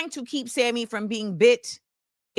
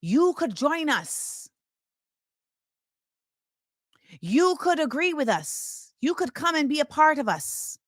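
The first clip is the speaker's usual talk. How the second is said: put-on Irish accent; 3 dB quieter than usual. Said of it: "in an Irish accent".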